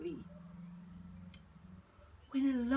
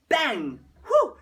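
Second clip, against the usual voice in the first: loud, high effect noise; loud bang; 5 semitones higher, 15 dB louder than usual. A narrator's reading. Narrator shouting the bang as the hare bites. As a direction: burst out the sound.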